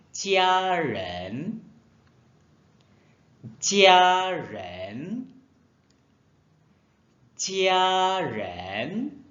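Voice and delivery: "jia ren" high and clear, repeated with pauses; model pronunciation. Shown as a demonstration. Teacher modelling "jia ren" for the class. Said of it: "kamu boleh tambah".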